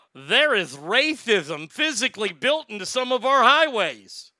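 mocking quote with a lisp, high and loud; Mayor Pete. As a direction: swoop through it in a lisping mock-politician voice. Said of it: "He came out and said, quote".

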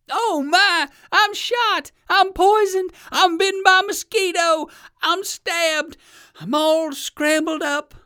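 high, loud wailing complaints; hammed-up cry of pain. Burned alligator wailing at the world, high loud howling. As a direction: howl as if your mouth is on fire. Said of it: "cried the alligator".

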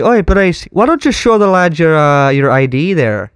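put-on Irish accent, needling a mate. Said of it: "super Irish".

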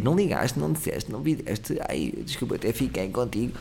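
soft reproachful whine; quietly reproachful, fading out; guilt-trip whine. Retold or imitated. Guilt-tripping quote. (a fazer chantagem emocional)

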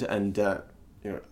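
ordinary talk, nothing marked

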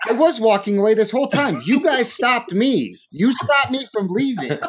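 very high-pitched retold indignation; exasperated protest. Diner to couple who blocked his exit, exasperated.

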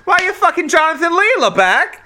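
high, fast, incredulous scolding; high-pitched scandalized outburst; mock outrage. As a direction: demand an answer in exaggerated exasperation.

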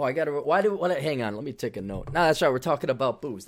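ordinary delivery

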